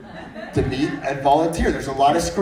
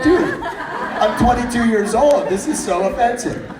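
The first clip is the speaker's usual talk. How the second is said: higher, slow mock outrage through laughter; mock offended, laughing; 10 semitones higher, 5 dB louder than usual. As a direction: pretend to be insulted while cracking up. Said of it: "And I'm like".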